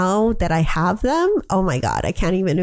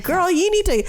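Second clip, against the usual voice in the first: exasperated mom; 12 semitones higher than usual. Retold or imitated. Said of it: "I'm like".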